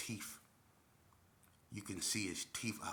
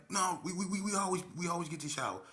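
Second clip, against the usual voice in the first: mock protest; 10 semitones higher, 5 dB louder than usual. Retold quarrel.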